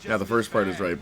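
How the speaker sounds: normal talking voice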